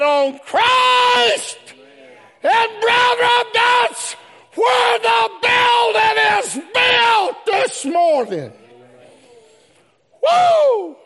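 high, shouted gospel preaching; slow-building, high-pitched revival shout; full-force preaching. Real, not acted. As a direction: belt it out to the whole church.

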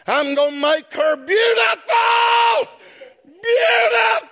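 soar on "beautiful"; joyous sermon climax; preaching shout. Real, not acted.

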